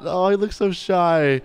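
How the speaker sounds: normal talking voice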